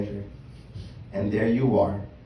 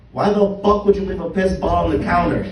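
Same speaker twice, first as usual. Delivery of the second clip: loud, high angry shout; 7 semitones higher, 9 dB louder than usual. Acted. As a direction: yell it at him in disgust. Yelling at father about bottle.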